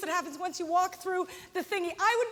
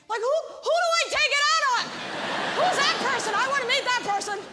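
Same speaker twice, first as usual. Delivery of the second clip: higher, louder, fast indignant retort; exasperated outburst; 5 semitones higher, 3 dB louder than usual. Retold or imitated. Comedian firing back at the airport guard in high loud indignation. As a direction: fire back in disbelief, laughing.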